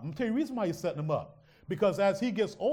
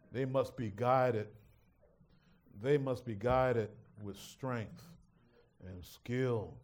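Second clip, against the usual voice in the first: solemn and slow; 6 semitones lower, 4 dB quieter, slower than usual. Real, not acted.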